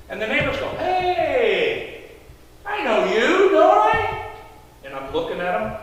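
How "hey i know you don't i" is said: lightly voiced neighbor, pitch climbing at the end; greeting neighbor. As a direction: call out in rising recognition. Neighbors greet him in retold story.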